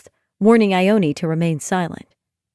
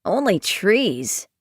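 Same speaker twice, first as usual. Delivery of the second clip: pointed and snide; slower than usual. Acted. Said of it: "pointed and snide".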